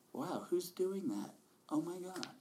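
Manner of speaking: amazed realization. Retold or imitated. Imitating amazed inner voice.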